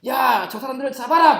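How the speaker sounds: loud, high-pitched order; commanding shout. Acted. Soldier ordering the others to catch the fugitives, shouting. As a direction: shout the order to seize them.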